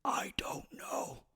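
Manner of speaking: slow unvoiced whisper back; mouthed. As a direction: mouth it without voice.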